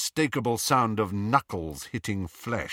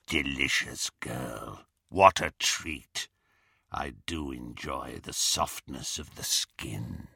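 low, slow, rasping relish; rasping menace; 7 semitones lower, 4 dB quieter than usual. A narrator's reading. Werewolf leering at captured girl, slow low rasp. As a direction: savour it slowly in a low rasp. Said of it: "rasping voice".

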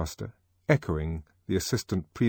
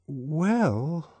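hesitant; 8 semitones higher than usual. A narrator's reading. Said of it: "began one of the boys and hesitated".

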